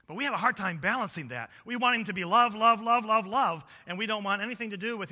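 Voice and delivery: high, loud, insistent repetition; forceful preaching. Real, not acted. Preacher chiding believers who want only love, forceful preaching. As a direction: pound out each repeated word.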